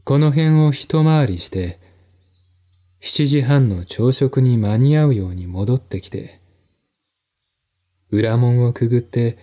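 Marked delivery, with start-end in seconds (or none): none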